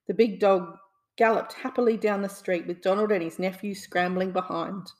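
usual speaking voice

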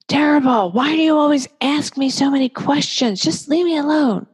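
put-on high, whiny teen snapping back; sulky teenager. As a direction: play a rude teen brushing off mom. Rude teenager answering parent.

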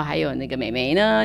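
usual speaking voice

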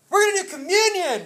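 high, loud cheer for communion; rallying shout. Real, not acted. Preacher rallying congregation to take communion, excited shout. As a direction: whoop it up to rouse the room. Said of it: "try that one more time".

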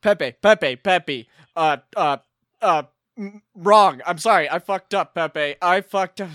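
loud, frantic apology; hammed-up panic; panicked confession. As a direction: blurt out the mistake in a panic.

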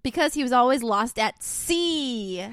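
slow, loud punchline; punchline delivery. Acted. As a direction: land the joke slowly and loudly. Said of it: "This is the joke of the day".

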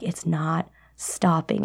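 slow, soft worried whisper; panicked whisper. Retold or imitated. Girl alone in bathroom realizing, worried whisper. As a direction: breathe it out slowly in dread.

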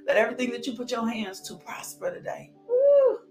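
usual speaking voice

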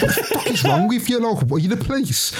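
high, laughing impression of an annoyed mum; mock exasperated mum. Mum snapping at her son over the seatbelt, high mock voice. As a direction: toss it off like an irritated mother. Mum's retort, mimicked high.

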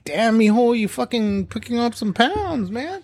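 high, drawn-out teasing aunt; mock the relative's blunt concern; nosy relative. Imitating family commenting on weight, teasing. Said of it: "always the fucking same thing".

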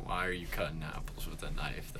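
mock-serious whisper. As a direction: lean in and whisper it. Real talk" aside to friends.

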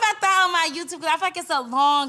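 excited, fast and loud; excited rush. Real, not acted. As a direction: rush it out, thrilled. Excited about her YouTube.

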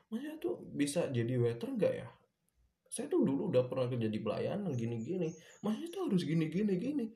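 mock bossy driver. Bossy delivery driver lecturing the waiter, mocking imitation. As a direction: mimic the driver talking down to him.

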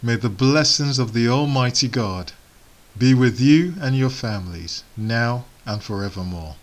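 normal talking voice

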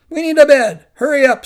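high and loud, impatient; loud demand. Acted. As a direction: hammer the demand home.

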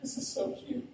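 put-on high, teasing coo over old flashcards; a young man gently mocking the cards. Young man to pastor, a teasing high coo.

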